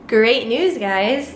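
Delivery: normal talking voice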